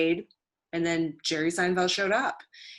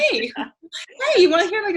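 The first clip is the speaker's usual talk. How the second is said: high, loud, over-keen interrupting; hammed-up attention seeker; eager pestering; 13 semitones higher, 9 dB louder than usual. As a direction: pester them to hear your joke. Acts pestering a celebrity with jokes.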